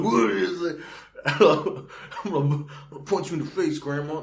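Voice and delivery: hammed-up teenage fury; mock raging teen. Raging teen threatening grandma, hammed-up and loud. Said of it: "raging with testosterone".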